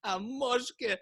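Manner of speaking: high, incredulous, amused question; high-pitched scoffing at the demand; laughing disbelief. Acted. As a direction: ask it through mock laughter. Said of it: "looks at him and starts laughing".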